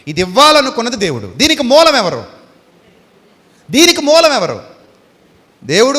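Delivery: loud, emphatic declaration of God as source; ringing sermon climax; preaching climax. Acted. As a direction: proclaim it to the whole hall. Climactic answer to congregation.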